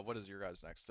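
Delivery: normal talking voice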